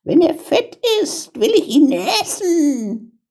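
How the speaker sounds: high-pitched, bossy witch; witch calling out. A narrator's reading. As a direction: order the girl about in a high witch voice. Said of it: "rüttelte sie wach und rief".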